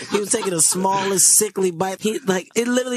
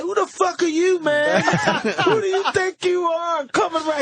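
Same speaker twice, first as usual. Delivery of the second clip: high, slow, indignant shout; mock angry comic; 10 semitones higher, slower than usual. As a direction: play the angry comic confronting him.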